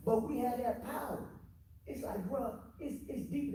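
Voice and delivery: getting fired up about the vision; excited. Real, not acted. Man telling friends about his dream, fired up and pitched high.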